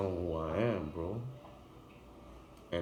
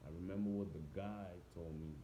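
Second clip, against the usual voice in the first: inner voice; 10 dB quieter than usual. Retold or imitated. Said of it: "in my head".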